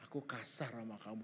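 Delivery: low, soft "Aku kasar sama kamu"; hushed apologetic re-enactment; retold confession. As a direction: admit it humbly, under the voice. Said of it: "saya ngomong gini".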